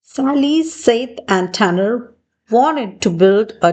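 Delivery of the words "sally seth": usual speaking voice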